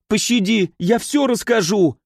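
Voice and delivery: high, desperate plea for mercy; pleading. Acted. Kazimir begs the prince while being bound, high pleading. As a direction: beg to be spared. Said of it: "взмолился Казимир".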